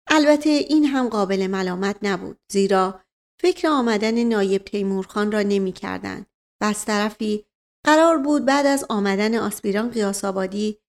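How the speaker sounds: usual speaking voice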